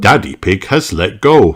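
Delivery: ordinary delivery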